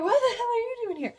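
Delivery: high, fast, laughing mock shriek; a hammed-up startled cry; startled yelp. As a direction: jump and yelp it, half laughing. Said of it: "it scares the shit out of Eleanor".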